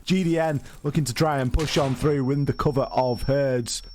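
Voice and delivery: high, quick play-by-play call; esports caster. Acted. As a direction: call the action live as it happens. Live play-by-play of the round.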